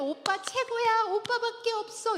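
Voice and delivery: high, loud mock adoration; hammed-up squeal of praise, stretched out; gushing girlfriend. Imitated girlfriend flattering her boyfriend while dating, high gushing.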